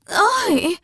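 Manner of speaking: high sudden yelp of pain; sharp high oi; pained cry. A narrator's reading. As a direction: cry out at the jab. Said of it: "the old lady cried".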